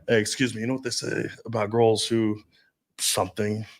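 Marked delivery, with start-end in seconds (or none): none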